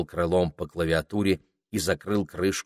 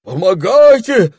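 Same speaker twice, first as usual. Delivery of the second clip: piercing, drawn-out cries for help; верещание; 16 dB louder, slower than usual. A narrator's reading. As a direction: squeal in panic, very high and loud. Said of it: "заверещала мадам Гортензия". (истошно верещать)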